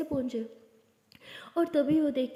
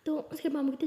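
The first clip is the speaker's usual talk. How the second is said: plain reading voice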